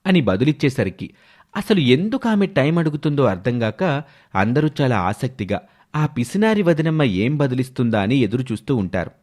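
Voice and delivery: usual speaking voice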